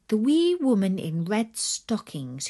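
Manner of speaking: usual speaking voice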